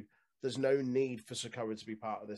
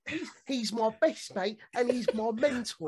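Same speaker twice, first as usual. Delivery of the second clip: English Squidward voice; 11 semitones higher, 15 dB louder than usual. Retold or imitated. Co-host mocking Nathan Fraser bragging about Seth Rollins, high and nasal. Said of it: "English Squidward voice".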